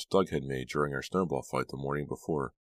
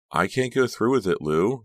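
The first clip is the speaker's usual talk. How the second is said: louder, higher, voice trembling; 7 semitones higher, 7 dB louder than usual. A narrator's reading. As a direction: plead with a shaking voice. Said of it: "her voice trembling".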